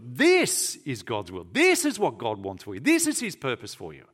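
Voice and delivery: "This" hit hard at the start of each line; preacher insisting on "This"; stress on this. Shown as a demonstration. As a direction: punch "This" every time, firm and steady. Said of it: "It's very emphatic".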